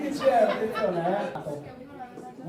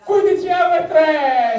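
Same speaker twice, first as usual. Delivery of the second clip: auctioneer call; 6 semitones higher, 10 dB louder than usual. Acted. Auctioneer raising bids.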